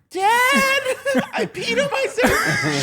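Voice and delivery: put-on high, whiny child laughing through it; squeaky little kid confessing. Kid voiced in story.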